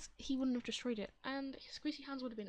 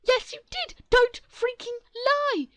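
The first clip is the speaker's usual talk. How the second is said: high, very loud yelling; shouting match; 12 semitones higher, 19 dB louder than usual. Acted. The family and the other mom shouting at each other. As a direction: yell it in their faces. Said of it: "shouting match".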